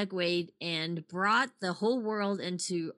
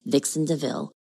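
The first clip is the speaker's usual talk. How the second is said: low, louder seductive announcer read; sexy announcer voice; 3 semitones lower, 6 dB louder than usual. Acted. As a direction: drop an octave and sell it. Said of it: "your sexy announcer voice".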